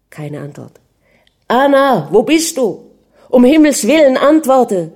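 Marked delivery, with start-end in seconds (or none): 1.5-4.9 loud, high, despairing cry; desperate shout. A narrator's reading. Prince searching the avenue for his missing wife, desperate shouting. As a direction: shout for an answer, frantic with worry. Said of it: "schrie er verzweifelt".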